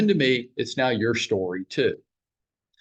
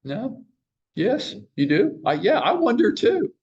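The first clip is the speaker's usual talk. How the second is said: playful homeowner chat; 9 semitones higher than usual. Acted. Role-plays talking to homeowner.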